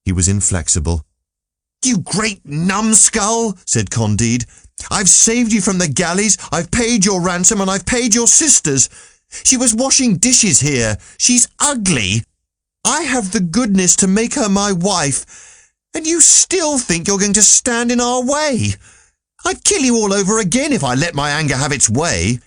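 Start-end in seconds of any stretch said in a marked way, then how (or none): 1.8-3.6 high, slow, outraged voice; furious insult. A narrator's reading. Candide rages at the Baron. 4.9-22.4 high-pitched indignant rant; angry tirade. A narrator's reading. Candide rages at the Baron.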